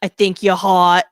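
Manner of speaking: slow, loud mock Boston cop; Boston accent. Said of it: "this cop ... was like".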